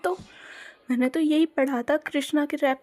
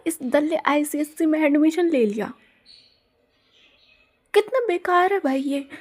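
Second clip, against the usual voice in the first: ordinary talk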